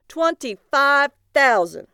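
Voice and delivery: high, loud, slow bid; calling out. A narrator's reading. Thompson tops out the bid, calling loudly. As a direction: ring the figure out across the room.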